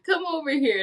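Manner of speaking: a hint of coaxing someone over; mock beckoning call; beckoning Bible shelf. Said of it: "shelf was calling me".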